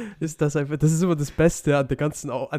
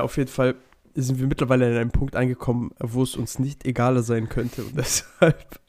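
ordinary talk

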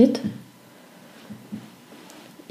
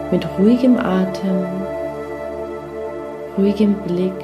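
guided meditation; 3 dB quieter than usual. Acted. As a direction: lull them gently into rest.

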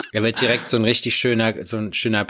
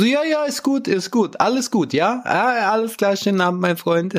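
high, exaggerated foreigner playing dumb; broken German foreigner voice; 10 semitones higher than usual. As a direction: brush them off in broken German, pitched way up. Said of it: "seine Deutschkenntnisse verloren".

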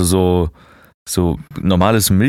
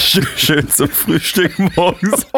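laughing; 10 semitones higher, slower than usual. Real, not acted. Witz über Döner zum Frühstück.

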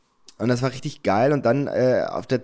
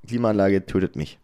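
ordinary delivery

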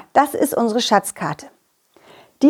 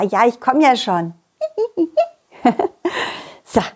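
ordinary delivery

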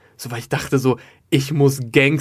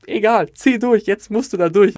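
higher, louder push to go through with it; rally yourself with raised pitch; urgent pep talk; 7 semitones higher, 4 dB louder than usual. Retold or imitated. Colleagues urge each other on before the interview, urgent pep talk.

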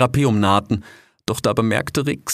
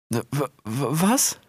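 stammering fox; 5 semitones higher, slower than usual. Acted.

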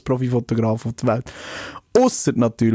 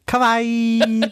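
cute kawaii voice; 11 semitones higher than usual. Pretend. Mimicking the kawaii intro.

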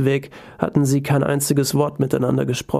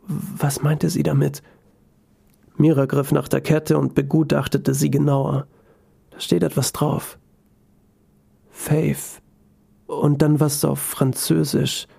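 ordinary delivery, nothing marked

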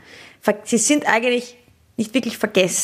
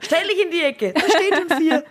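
mock strict teacher order; 7 semitones higher than usual. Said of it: "ich würd die Schüler anschreien".

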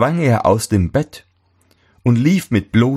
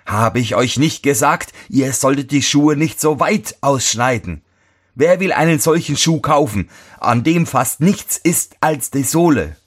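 mock yelling; 7 semitones higher than usual. A narrator's reading. Master upbraids apprentice over the shoe, mild narrated yelling. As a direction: scold with raised pitch rather than volume. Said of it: "schrie er ihn an".

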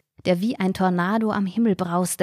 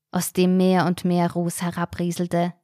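plain reading voice

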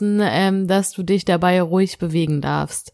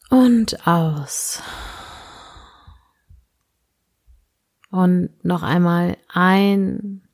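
sleep meditation; slower than usual. Acted. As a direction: guide the listener gently into sleep.

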